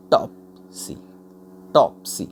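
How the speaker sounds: "टॉपसी" broken in two, टॉप punched, सी dropped; stress on first syllable. Shown as a demonstration. Teacher repeating a trochee example, stress on टॉप. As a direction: punch टॉप, then let सी fall away unstressed. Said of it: "पहला जो sound उस पे जोर देना है".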